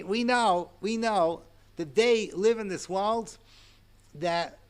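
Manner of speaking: ordinary talk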